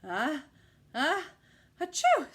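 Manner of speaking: rising ah ah to a loud achoo; building sneeze. A narrator's reading. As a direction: act out a big sneeze. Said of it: "sneezed the lion".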